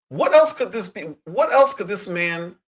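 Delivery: outraged rhetorical question; incredulous. Real, not acted. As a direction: ask it again, hardly believing it. Repeating question in disbelief.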